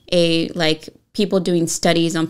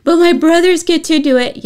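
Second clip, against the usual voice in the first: put-on whiny child; 11 semitones higher, 7 dB louder than usual. Mother voices daughter arguing.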